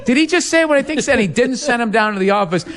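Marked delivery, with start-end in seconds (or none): 0.0-1.2 high, laughing teacher quote; laughing mock disbelief. Friend retelling the teacher's reaction, laughing high. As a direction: giggle through the teacher's stunned question. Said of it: "he played it off like".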